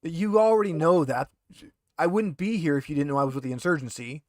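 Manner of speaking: a hint of a rattled, defensive prisoner pleading innocence; lightly voiced handcuffed thug, indignant then wheedling. GM as the interrogated prisoner, lightly voiced and defensive.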